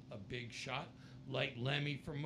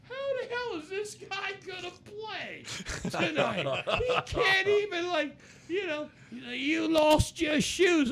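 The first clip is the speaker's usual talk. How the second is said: loud, much higher, laughing disbelief; incredulous; 12 dB louder than usual. Retold or imitated. John doubting the shoeless Lemmy can play, loud and laughing. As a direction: laugh it out in amazement. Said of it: "I'm like".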